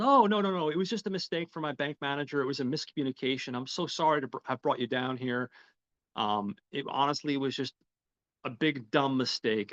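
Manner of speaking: apologetic. Retold or imitated. Bank manager covers up to police, profusely apologetic.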